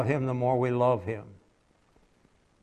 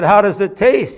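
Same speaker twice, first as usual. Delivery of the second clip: higher voice for the curious man; the man asking; 6 semitones higher, 9 dB louder than usual. Retold or imitated. Preacher voicing a man asking a boy. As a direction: voice the stranger questioning the boy.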